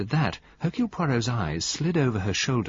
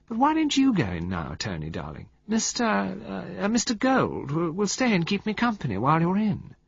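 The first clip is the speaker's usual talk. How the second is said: sweet murmur; 7 semitones higher than usual. Acted. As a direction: coo it like a pampered wife. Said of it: "Valentine murmured".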